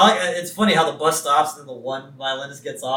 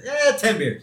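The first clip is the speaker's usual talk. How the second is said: offhand admission, pitched up; mock nonchalance; 8 semitones higher, 3 dB louder than usual. Co-host imitates Homer admitting drinking, mock nonchalant. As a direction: toss it off as no big deal. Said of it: "I love how nonchalant he is".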